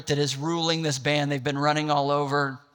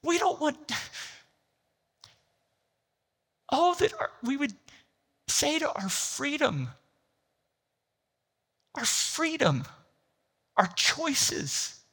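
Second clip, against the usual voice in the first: much higher, straining preacher's cry; impassioned preaching; 10 semitones higher than usual. Real, not acted. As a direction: preach it with fervour at full force. Preaching at full force.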